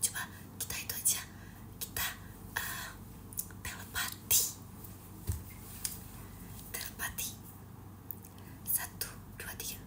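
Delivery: very soft, voiceless whisper. Real, not acted. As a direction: whisper it, barely any sound. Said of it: "Kita bisik-bisik ya". (berbisik pelan)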